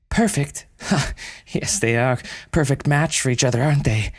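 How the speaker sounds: high, bitter scoffing laugh. Acted. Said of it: "interrupted again bitterly".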